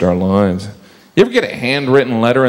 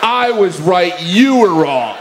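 high, slow gloating taunt; mocking taunt; 10 semitones higher, slower than usual. Spell check gloating at the speller, high and slow. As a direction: gloat like a smug machine.

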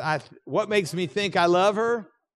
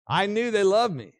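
ordinary talk, nothing marked

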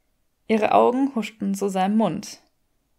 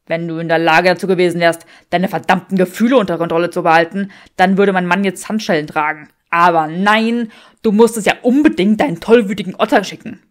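loud, fast rant, slowing on aber nein; man ranting at his partner; angry Draco; 9 dB louder, faster than usual. Acted. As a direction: rattle it off in a loud fury. Draco yells back at Granger.

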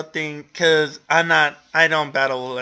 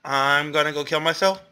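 ordinary talk

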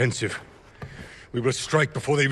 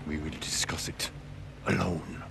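softer, lower firm warning; stern low rebuke; 5 semitones lower, 9 dB quieter than usual. Acted. Uncle silencing his nephew before others, low stern rebuke. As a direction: shut him down quietly.

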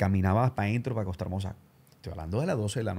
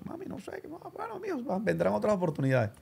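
much higher, soft mom voice; high mother voice; 8 semitones higher, 4 dB quieter than usual. Retold or imitated. Son retells mom consoling him, high soft voice.

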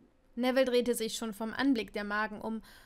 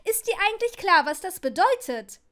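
higher and louder, shrill cry echoing; shrill call; 7 semitones higher, 9 dB louder than usual. Acted. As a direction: shriek it at him. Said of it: "rief sie, schrille Stimme". (Ginny ruft schrill in der Jungstoilette)

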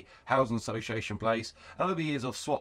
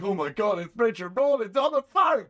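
high, loud fan outburst; starstruck excitement; 10 semitones higher, 6 dB louder than usual. Retold or imitated. Fan hears his hero on the phone, wildly excited. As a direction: re-enact a fan losing it, voice shooting up. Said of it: "sliding off my seat with excitement".

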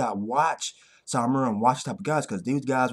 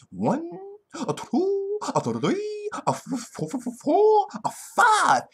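put-on high-pitched children's-show counting voice; stuttering, high mock kids'-TV count; Sesame Street counting; slower than usual. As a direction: count along playfully, leaping up high. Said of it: "I'm about to go Sesame Street".

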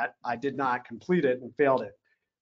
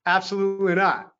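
higher-pitched, firm retold refusal; imitated colonel refusing outright; emphatic refusal; 5 semitones higher, 4 dB louder than usual. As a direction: shut the request down firmly.

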